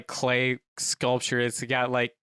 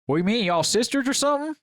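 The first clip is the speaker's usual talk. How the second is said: higher, quicker, baffled question; clueless guy; 7 semitones higher, 4 dB louder, faster than usual. Acted. Clueless man questioning the couple, baffled. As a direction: fire it off puzzled, pitch climbing to the end.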